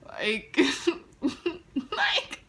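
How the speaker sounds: high laugh through the words. Real, not acted. Telling viewers about the kiss, laughing.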